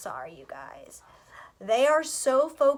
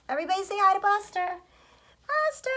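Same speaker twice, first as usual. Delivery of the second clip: high pet voice; 9 semitones higher, faster than usual. Real, not acted. Introducing dog to viewers.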